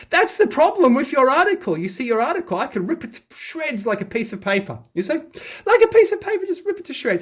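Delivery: taunting. Real, not acted. Taunting the article's author.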